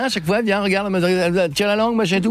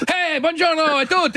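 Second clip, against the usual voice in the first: high, slow hammed-up Italian pizzaiolo greeting; high, slow mock Italian accent; 12 semitones higher than usual. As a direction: play a jolly Italian pizza man. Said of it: "parler qu'un accent italien".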